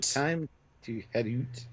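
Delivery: slow Canadian "oot" for out; deliberate, slow Canadian vowels; mock Canadian accent. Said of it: "you're better at it than I am".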